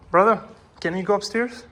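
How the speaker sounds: calling out. Real, not acted. Visitor asking brother about upstairs, calling out. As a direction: call over to someone at a distance.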